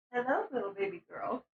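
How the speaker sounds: soft, fast, lightly voiced flirty purr; a hint of the love interest teasing; imitating his voice. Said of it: "recorded a little laugh track for him".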